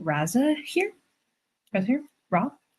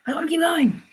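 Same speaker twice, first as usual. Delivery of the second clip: high whiny plea; higher pleading whine; whiny longing; 11 semitones higher than usual. Real, not acted. Repeated wish, second higher.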